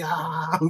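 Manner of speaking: high, held-back wordless scream; imitated madman's scream; mock scream. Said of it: "He starts screaming out".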